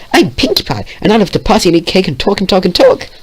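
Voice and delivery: high, fast hyper chatter; hyper bubbly girl. Acted. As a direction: rattle it off with glee. Pinkie introducing herself.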